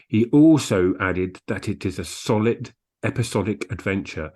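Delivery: normal talking voice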